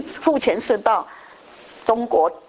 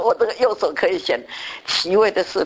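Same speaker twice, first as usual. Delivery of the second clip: normal talking voice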